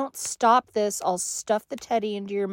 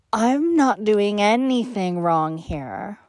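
normal talking voice

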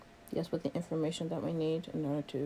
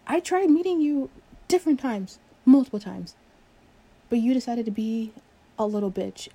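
raised, indignant voice; louder, fed-up complaint; exasperated; 4 semitones higher, 7 dB louder than usual. Acted. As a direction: burst out in frustration. Acted quarrel with a coworker.

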